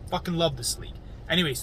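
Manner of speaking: usual speaking voice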